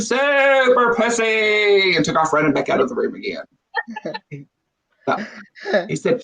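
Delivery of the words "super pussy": mock scream. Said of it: "She screamed".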